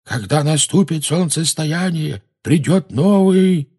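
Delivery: high, slow prophetic intoning; drawn-out, high voice of a prophesying spirit; ghostly old man. A narrator's reading. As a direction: intone it like an apparition, stretched out. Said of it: "сказала фигура старика с бородой".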